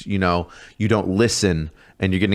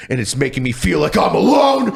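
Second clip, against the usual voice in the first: much higher and louder, hammed-up cry for a friend; mock lonely wail; 10 semitones higher, 3 dB louder than usual. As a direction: whine like a drill sergeant faking loneliness. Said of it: "Then I would be like".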